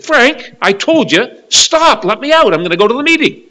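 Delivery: high, loud, insistent retold demand; upset wife. Wife insists her husband let her out, acted upset. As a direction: raise your voice as a wife who has had enough. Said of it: "she got a little upset".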